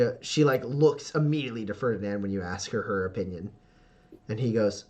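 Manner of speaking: ordinary delivery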